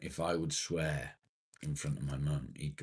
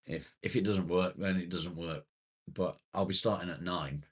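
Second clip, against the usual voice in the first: normal talking voice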